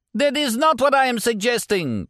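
a pained, indignant warlord denying it; groaning warlord. A narrator's reading. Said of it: "the warlord groaned".

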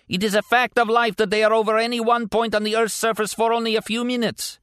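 a mad warlord explaining the obvious to an idiot; mad warlord speech. A narrator's reading.

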